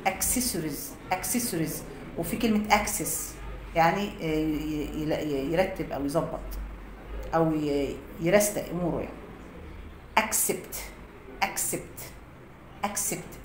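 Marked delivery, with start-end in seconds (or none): 0.1-1.7 "accessories" drawn out slowly, the first c as k and the double c as ks; careful pronunciation demo. Shown as a demonstration. Teacher slowly repeats "accessories" for students. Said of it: "زي كلمة accessories". 10.2-13.5 "accept" whispered, cc as ks, ak-sept; whispered pronunciation demo. Shown as a demonstration. Teacher whispers "accept" for students to repeat. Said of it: "يوافق".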